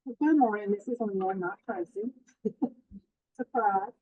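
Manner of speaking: usual speaking voice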